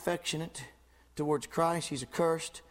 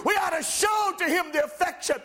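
high, ringing pulpit voice, climbing to a shout; fervent preacher lifting the room; full-force preaching; 11 semitones higher, 5 dB louder than usual. Acted. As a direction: preach it out to the whole church. Preacher building to climax.

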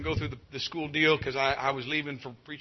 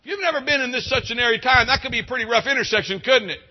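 higher, louder call across the courtroom; officer hollering; 7 semitones higher, 8 dB louder than usual. Retold or imitated. Officer calling across the courtroom to Joe, loud and higher. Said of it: "he hollered back to another highway patrol".

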